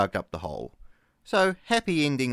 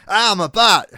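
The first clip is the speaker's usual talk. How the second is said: high, loud retold yelp of pain; imitating a ranger's shocked yelp; startled yelp; 11 semitones higher, 12 dB louder than usual. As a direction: yell it like you've just been bitten on the backside. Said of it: "he jumps up and goes".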